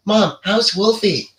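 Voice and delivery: high, slow mimicked boy's voice; John Connor. Imitating the Terminator faking the boy's voice, high mimicry. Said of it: "Mimics the John Connor's voice".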